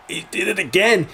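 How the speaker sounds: high-pitched disbelief, not yet shouted; amazed realization. Retold or imitated. His reaction to the repeated line.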